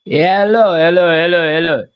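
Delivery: loud call into the room; calling down a hallway. Real, not acted. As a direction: call out hello to anyone listening.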